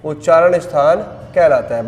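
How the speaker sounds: each phrase said twice with long pauses; slow dictation. Shown as a demonstration. Teacher dictating a definition to students, word by word. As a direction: dictate slowly so the class can write.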